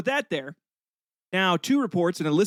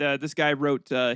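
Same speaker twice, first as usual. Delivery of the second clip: ordinary talk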